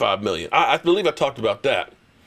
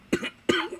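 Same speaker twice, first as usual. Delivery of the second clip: a quieter choking cough; real cough; 6 dB quieter than usual. Real, not acted. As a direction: cough as if something went down wrong. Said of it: "I'm choked".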